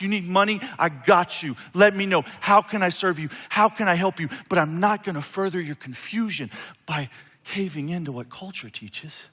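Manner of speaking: ordinary delivery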